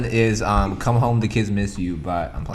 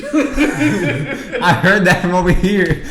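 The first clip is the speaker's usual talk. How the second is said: slow words squeezed through laughter; cracking up mid-sentence; laughing; 12 semitones higher, 7 dB louder, much slower than usual. Real, not acted. Laughing at a friend's whisper.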